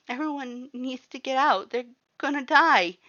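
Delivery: high, loud panicked plea; urgent terror, almost screaming; frightened girl begging everyone to flee. A narrator's reading. Panicked warning of fire.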